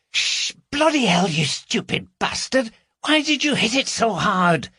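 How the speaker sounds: furious but keeping it down; angry scolding. Acted. Carol scolds Gary at shattered glass.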